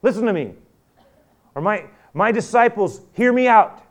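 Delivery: high, loud address to grown men; loud rallying call. Retold or imitated. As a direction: call them like a commander. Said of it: "he doesn't say".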